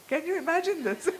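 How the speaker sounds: real astonishment, nearly laughing; amazed exclaim. Real, not acted. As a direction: share the astonishment with the room. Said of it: "I was completely astonished".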